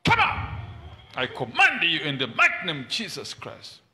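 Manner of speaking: usual speaking voice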